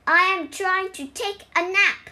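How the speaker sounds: high, loud complaint; exasperated cry. A narrator's reading. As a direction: protest like a dragon disturbed from rest. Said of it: "he cried".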